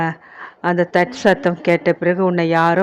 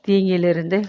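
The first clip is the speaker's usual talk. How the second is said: ordinary delivery, nothing marked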